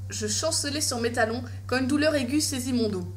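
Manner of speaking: ordinary delivery, nothing marked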